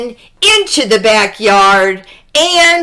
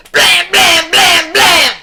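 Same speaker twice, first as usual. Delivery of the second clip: knocking sounds; 7 semitones higher, 3 dB louder than usual. Acted. Knocking on the door.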